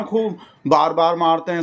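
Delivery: ordinary talk, nothing marked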